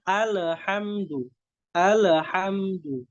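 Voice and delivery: "alhamdu" with the lam wrongly bounced; bounced lam mistake. Shown as a demonstration. Teacher imitating the common mistake, bounced lam. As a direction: pop the l in "alhamdu" like a qalqalah, wrongly. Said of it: "Itu kesalahan".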